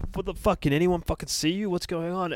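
high-pitched panicked questions; acted-out freak-out, pitched well up; mock screaming. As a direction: imitate a screaming panic without full volume. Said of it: "She starts screaming".

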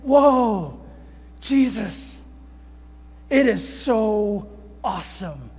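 lightly voiced Peter, slow and starstruck; a hint of a wide-eyed disciple blurting out; awed Peter. Peter blurting to Jesus on the mountain, slow and amazed. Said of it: "I kinda think of Peter saying".

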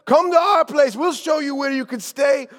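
high, loud hawker's call. Acted. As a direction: call out over the crowd to sell lodging. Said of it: "raised his voice said".

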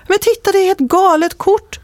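can't believe the card in front of him; high, amazed outburst; stunned exclamation. Retold or imitated. Man stunned by photo.

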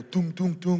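punchy rhythmic "tung" sound effect; imitated impact noise. Preacher mimicking Kung Fu Panda strikes, punchy sound effect. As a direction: imitate film-style strikes, short and ringing. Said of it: "who've ever seen Kung Fu Panda?".

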